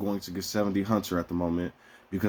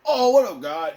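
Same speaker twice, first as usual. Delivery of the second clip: much higher, louder cheery hey; casual greeting to God; 10 semitones higher, 4 dB louder than usual. Retold or imitated. Acting out greeting God when in trouble. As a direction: hail God like an old buddy. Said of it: "I'm like".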